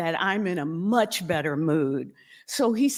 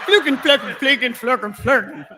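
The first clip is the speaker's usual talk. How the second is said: laughing mock German; 3 semitones higher, 4 dB louder, faster than usual. Mimics broken German again laughing.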